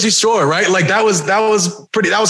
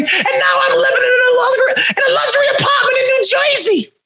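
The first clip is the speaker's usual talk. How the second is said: high laughing shout of a gloating heel; mock villain boast; 12 semitones higher, faster than usual. Taunting fans about his apartment, high laughing gloat. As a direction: crow about the luxury apartment.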